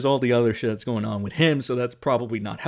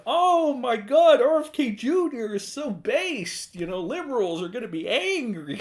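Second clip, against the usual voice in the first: sarcastic fake delight, pitched up high; mock gushing fan; 9 semitones higher than usual. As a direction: ham up a starstruck fanboy. Mocking fans praising RFK Junior.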